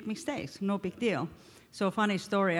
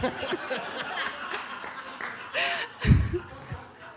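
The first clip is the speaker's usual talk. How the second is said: a real laugh pitched up and trailing off; long laugh; 10 semitones higher than usual. Real, not acted. Woman laughing at her own boyfriend mix-up, helpless laugh. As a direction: let the laugh run out.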